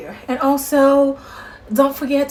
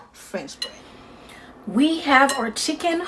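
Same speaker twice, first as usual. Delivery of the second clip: ordinary talk, nothing marked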